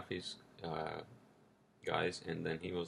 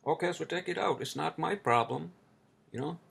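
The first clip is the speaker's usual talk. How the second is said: higher and louder, snapping back; angry retort; 10 semitones higher, 5 dB louder than usual. Retold or imitated. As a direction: throw it back at him, fed up. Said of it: "I was so pissed".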